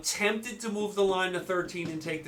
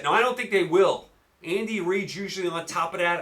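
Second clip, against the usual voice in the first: ordinary talk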